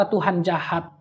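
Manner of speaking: diucapkan pelan dan lebih rendah, seperti bergumam dalam hati; suara hati pelan. Retold or imitated. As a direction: ucapkan lirih seolah hanya untuk diri sendiri. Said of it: "Dalam hati saya berkata". (bisikan hati yang getir)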